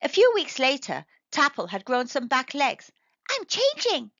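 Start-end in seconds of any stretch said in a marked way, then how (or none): 3.3-4.1 very high, thrilled squeak; excited. A narrator's reading. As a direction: burst with delight. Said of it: "he thought excitedly".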